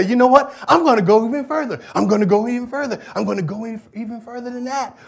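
high, loud and fast, building excitement; excited vow. Retold or imitated. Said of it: "And they say".

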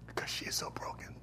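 whispered, hushed and soft; the preacher whispering the woman's brokenness. Shown as a demonstration. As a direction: whisper it to the room. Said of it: "She's broken".

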